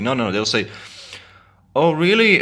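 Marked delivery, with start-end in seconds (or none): 1.7-2.4 high, loud sarcastic "really". Retold or imitated. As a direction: toss it off with sarcasm. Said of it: "in a sarcastic tone".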